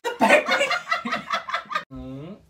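squealing laughter while tickled; being tickled. Real, not acted. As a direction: laugh helplessly, high and loud. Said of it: "I'm ticklish".

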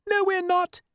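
very high-pitched squabbling voice; contradicting passenger. A narrator's reading. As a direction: contradict in a squeaky character voice. Passengers argue aboard the falling peach.

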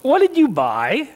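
hammed-up accusation, pitch swooping; mock-suspicious wife. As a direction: pounce on the question like a suspicious wife. Imagined wife suspects husband.

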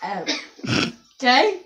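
ordinary talk

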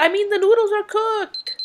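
high, happy announcement; excited. Pretend. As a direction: cheer it out. Cheer for cooked noodles.

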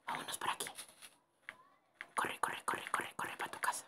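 hushed, very soft whisper; urgent whisper. Real, not acted. Player urges herself to run home, urgent whisper. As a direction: whisper it to yourself while sneaking off. Sneaking away in horror game.

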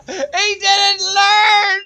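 very high, loud, slow laughing yell; laughing shout. Real, not acted. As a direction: scream it through tears of laughter. Shouting while laughing at opponent.

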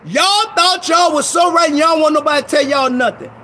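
rising to a full preaching shout. Real, not acted. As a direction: hammer it home, loud and high.

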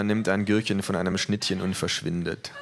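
plain reading voice

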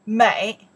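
美 pronounced loudly on its own; model pronunciation. Shown as a demonstration. Teacher pronounces the part on the side, loud 美. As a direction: project 美 for learners to copy. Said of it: "we pronounce the".

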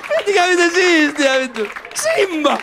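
high, loud excited outburst. Real, not acted. Comedian telling the audience, high and loud with excitement. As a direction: burst out with delight. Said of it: "ihan vitu innoissani".

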